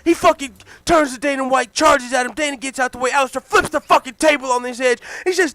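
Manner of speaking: ordinary delivery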